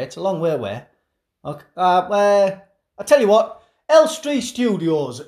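ordinary delivery

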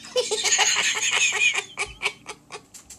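high, wild, drawn-out villain cackle; insane cackle. Pretend. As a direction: cackle like a mad villain trying not to laugh. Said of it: "I want insane cackle".

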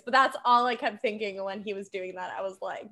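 ordinary talk, nothing marked

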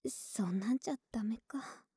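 hushed, breathy bedtime whisper close to the ear; soft whisper. Acted. Girlfriend whispering her worries to her partner in bed.

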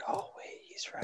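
hushed unvoiced whisper. Real, not acted. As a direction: whisper it like a secret. Sudden hushed realization.

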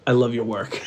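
low-pitched, very quiet murmur; imitated soft voice. Relative saying goodbye before he just walks away, soft murmur. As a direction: slip it in low and confidential. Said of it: "you say a little soft voice".

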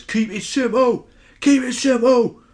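high, loud Cockney coach yelling from the touchline; screaming coach. Acted. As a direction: scream it like a red-faced football coach. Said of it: "screaming at the top of his voice".

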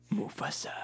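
unvoiced whisper; hushed Mufasa. Hyenas whispering Mufasa's name to scare each other. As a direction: breathe the name out.

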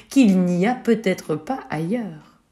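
usual speaking voice

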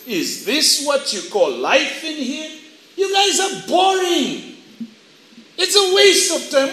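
rising to a high, scornful complaint; taunting suggestion. Acted. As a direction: needle them in a high put-on voice. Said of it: "begun to suggest to the church".

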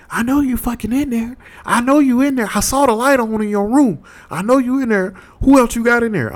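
very high, screechy mock female voice; angry woman at the door. Woman at the locked door accusing the roommate, high mock rant.

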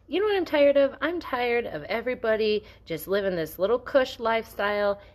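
high-pitched, exasperated venting; pissed-off rant. Real, not acted. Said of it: "I'm effing pissed".

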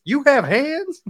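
high, incredulous question; mock disbelief. First thing said on seeing a friend, high mock disbelief. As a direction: pitch it way up in imitated shock. Retold character's shock, repeated.

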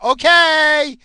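squeaky, loud, dopey okay; mock gullible okay. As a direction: play the sheep who swallows anything. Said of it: "People just be like".

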